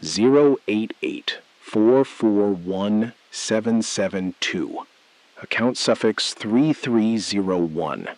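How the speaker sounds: slow, deliberate digit readback; reading digits back slowly for the caller. Acted. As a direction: space each number out carefully. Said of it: "Say it back slowly".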